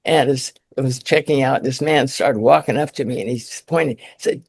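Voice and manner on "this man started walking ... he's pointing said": normal talking voice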